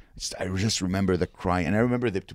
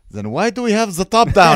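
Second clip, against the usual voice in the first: loud, high acted film quote; shouted punchline; 10 semitones higher, 12 dB louder than usual. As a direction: call it out loudly as a movie character.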